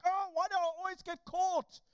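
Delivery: high, loud frustrated whine. Retold or imitated. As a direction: whine as if cut off right at the worst moment. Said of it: "and it's like".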